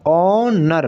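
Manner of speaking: ऑनर stretched into two syllables, ऑ-नर; split into syllables. Shown as a demonstration. Teacher shows students two syllables, drawn out. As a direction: draw out the ऑ, then add नर. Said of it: "दो syllable आ रही है".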